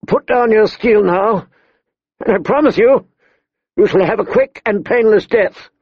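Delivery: put-on high, strained young lord's challenge; called-out command. A narrator's reading. Young lord to brother's captors, calling out with strain. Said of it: "Robb called out".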